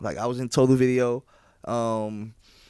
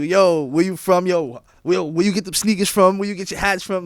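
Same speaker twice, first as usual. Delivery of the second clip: higher, louder pestering questions; aggressive; 6 semitones higher, 5 dB louder than usual. Retold or imitated. Rapper imitating Angolan fans asking about his clothes, aggressive. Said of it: "They're aggressive".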